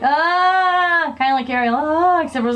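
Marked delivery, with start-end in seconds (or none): 0.0-1.1 long, high "ah" cry; re-enacted drawn-out yelp, pitched up; imitated startled noise. Girl feeling a swamp thing on her leg, imitated startled cry. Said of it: "I made this noise like". 1.8-2.2 high imitated "ah"; imitated startled noise. Sister showing the noise she made in the pool, imitated cry. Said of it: "I made this noise like".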